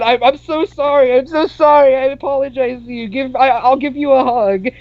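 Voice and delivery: over-apologize like a nervous wreck; hammed-up jittery pleading; nervous apology. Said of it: "And I was so nervous".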